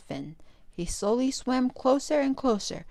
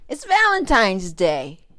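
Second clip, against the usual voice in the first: louder, slow drawn-out call; 9 dB louder than usual. A narrator's reading. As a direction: call the news across the house. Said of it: "he called out".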